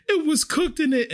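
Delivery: very high, whiny panic in imitation; hammed-up hysterical diner, pitch way up; mock freak-out. Customer panicking to the waitress about her food, high mock wail. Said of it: "But she is freaking out".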